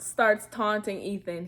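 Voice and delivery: normal talking voice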